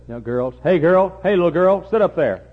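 put-on calls out loud and high at a fidgeting girl; scolding preacher shout. Preacher scolding a girl in the pew, shouting. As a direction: bark the order across the room to the child. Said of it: "He said".

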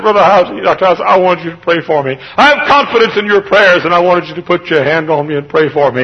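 loud, high-pitched plea; pleading. Retold or imitated. Sick man begs his pastor for prayer, loudly pleading. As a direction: beg urgently at preaching volume.

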